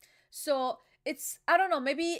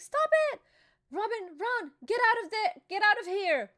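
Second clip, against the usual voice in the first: high, loud panicked scream of warning; retold desperate yell; 8 semitones higher, 4 dB louder than usual. Nami screaming at Robin to run, panicked shout.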